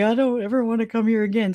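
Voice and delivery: high, exasperated quote of herself; lightly mocking vow never to return; fed-up quote. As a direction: pitch it up like you're done with the place. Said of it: "and I was just like".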